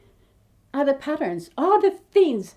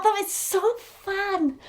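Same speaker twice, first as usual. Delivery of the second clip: higher, slower, dreamy repeat of so fun; a longing echo, higher and drawn out; wistful repeat; 7 semitones higher, slower than usual. Real, not acted. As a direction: say it again, drifting up and slowing down as if picturing it.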